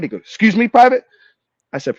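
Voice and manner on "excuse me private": loud, high-pitched challenge to a private; acted officer's sharp rebuke; affronted officer. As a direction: pull rank in outraged disbelief. Officer reacts to private's outburst.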